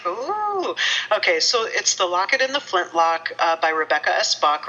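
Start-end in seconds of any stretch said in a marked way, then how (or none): 0.1-0.8 high, drawn-out "ooh"; playful retold "ooh", pitched right up; intrigued ooh. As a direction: recreate an intrigued reaction with a long high ooh. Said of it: "I was like".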